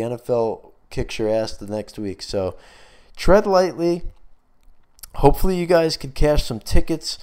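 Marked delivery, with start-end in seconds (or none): 3.2-7.2 out of breath. Real, not acted. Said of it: "I'm running out of breath, uh, right now and a voice".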